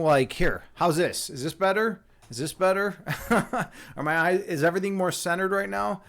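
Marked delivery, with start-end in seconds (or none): 3.0-3.9 short amused laugh. Real, not acted. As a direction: burst of laughter at himself. Laughing at own camera fussing.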